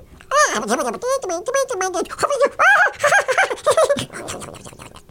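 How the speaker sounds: loud, very high squirrel jabbering; squirrel chatter. Shown as a demonstration. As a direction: act out a story in frantic squirrel chitters. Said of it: "read his lines in squirrel".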